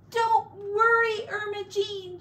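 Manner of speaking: comforting. Acted. Missus Potter comforting the piglet, high and soothing. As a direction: reassure the little pig fervently. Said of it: "she comforted the baby pig".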